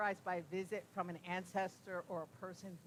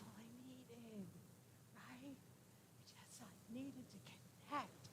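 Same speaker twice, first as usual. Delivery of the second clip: softer, whispered confession; 4 semitones higher, 12 dB quieter than usual. Real, not acted. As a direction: breathe it out as a whisper, moved. Tender memory of needing connection.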